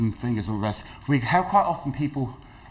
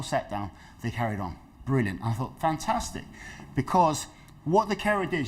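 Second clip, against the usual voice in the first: ordinary delivery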